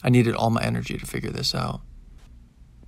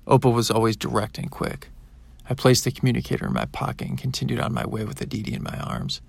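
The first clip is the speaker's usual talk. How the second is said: plain reading voice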